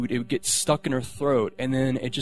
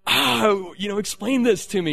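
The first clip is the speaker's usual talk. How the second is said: frustrated plea; 8 semitones higher, 3 dB louder than usual. Retold or imitated. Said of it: "so I was like".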